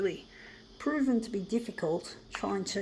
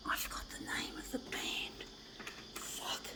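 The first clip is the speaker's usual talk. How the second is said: whispering; 12 dB quieter than usual. Real, not acted. Muttering to himself, forgot band.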